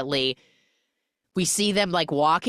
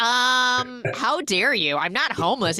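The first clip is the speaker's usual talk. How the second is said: mock indignant; 8 semitones higher than usual. Indignant heroine, teller laughing.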